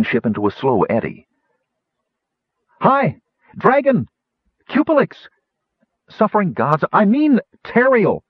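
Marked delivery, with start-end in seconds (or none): 2.8-8.2 high-pitched calling out; yelling. A narrator's reading. Kitiara hails the dragon flying past, yelling. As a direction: hail someone far off in separate high cries. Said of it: "Kitiara yelled".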